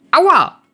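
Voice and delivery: high, loud ouch; yelp of pain. Acted. Gets a smack on bottom.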